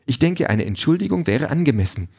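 high, prim, offended voice; indignant drone. A narrator's reading. Drone expects an apology from Peter, offended. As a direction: demand amends with wounded dignity. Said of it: "sagt die Drohne empört".

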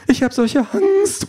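high, slow wail of fear; very high scared call; frightened cry. A narrator's reading. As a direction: squeal it in fright, drawn out. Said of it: "ruft sie".

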